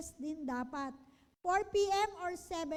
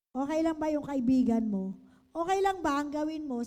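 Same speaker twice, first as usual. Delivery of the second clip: emphatic ask; 8 dB louder than usual. Shown as a demonstration. Loud emphatic coaching questions.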